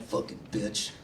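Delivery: a sneering, voiceless mock curse; mock bitter whisper. Mocking his imagined jealous self, a sneering whisper. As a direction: hiss it out like a sour misogynist caricature. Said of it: "I'm like".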